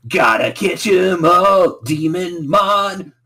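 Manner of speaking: high, loud, drawn-out jingle parody; mock slogan shout. Co-host mocking the catch-'em-all slogan, hammy and loud. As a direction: belt it out like a cheesy theme slogan.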